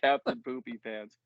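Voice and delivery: saying it through real laughter; laughing. Real, not acted. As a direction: try to get the silly name out while laughing. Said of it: "I can't say that without laughing".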